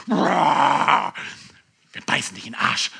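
low, gruff mock threat from the dogs. Preacher voicing the dogs of doom, low and gruff. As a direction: growl it low like a menacing dog.

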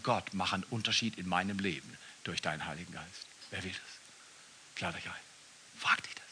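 very soft, low prayer; quiet prayer. Acted. Preacher drops to prayer.

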